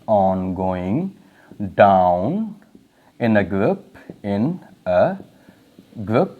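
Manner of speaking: word by word with long pauses for note taking; dictating to the class, each word split off by pauses; slow dictation. Shown as a demonstration. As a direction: spell it out slowly so students can write. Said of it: "हम short में लिखें".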